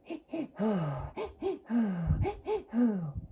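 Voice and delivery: repeated double inhale and long exhale; breathwork breathing pattern. Shown as a demonstration. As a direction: keep the breath cycling, sighing out each time. Demonstrates the continuous breathing pattern.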